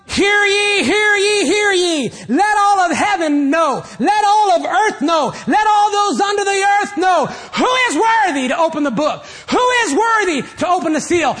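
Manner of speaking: loud, high crier's proclamation; town crier. Acted. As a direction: call out to all heaven and earth. Angel heralds like a crier.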